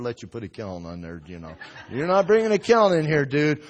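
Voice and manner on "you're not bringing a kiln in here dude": loud, raised-pitch protest; mock loud refusal. Pastor refusing a kiln in the church, mock loud refusal. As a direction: put your foot down loudly.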